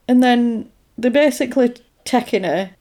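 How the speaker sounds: normal talking voice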